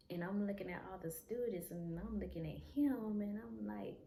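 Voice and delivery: ordinary talk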